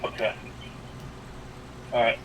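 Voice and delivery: ordinary talk, nothing marked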